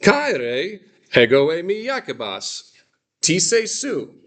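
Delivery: high, lively greeting in character; voicing Jacobus. Teacher voicing Jacobus's greeting, high and lively. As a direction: play Jacobus cheerfully introducing himself. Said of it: "Jacobus says".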